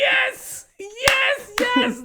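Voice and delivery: high-pitched ecstatic fan reaction; thrilled cry. Retold or imitated. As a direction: cry out high in delighted triumph. Retells own thrilled reaction to line.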